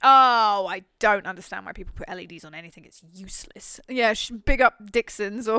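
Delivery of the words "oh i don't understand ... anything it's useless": ordinary talk, nothing marked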